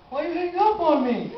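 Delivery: high, hurt voice of a father; disappointed father. Retold or imitated. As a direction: play the father, wounded he was hung up on. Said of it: "the person sounds more disappointed".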